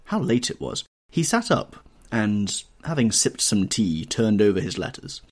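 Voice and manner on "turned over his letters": plain reading voice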